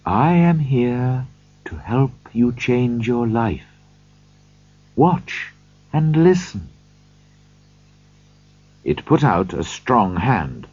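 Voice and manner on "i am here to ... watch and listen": slow, gentle ghostly voice. Acted. The spirit's voice.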